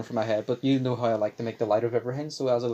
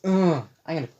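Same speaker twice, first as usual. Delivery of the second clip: real crack, pitch jumps up; frustrated groan as the voice breaks; 6 semitones higher, 6 dB louder than usual. Real, not acted. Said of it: "Why is my voice like this".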